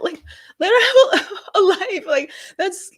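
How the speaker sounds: high laughing outburst of frustration; exasperated laugh. Real, not acted. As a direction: laugh out the plea in disbelief.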